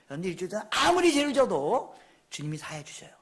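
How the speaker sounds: ordinary talk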